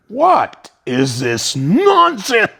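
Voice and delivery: slow, loud, stern "What is this nonsense?"; angry guru rebuke. Retold or imitated. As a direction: let it hit like a hurricane. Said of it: "and he goes".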